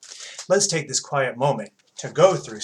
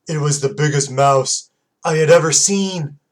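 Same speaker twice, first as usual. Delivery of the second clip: excited reveal; 2 semitones higher, 7 dB louder than usual. A narrator's reading. Story climax, louder.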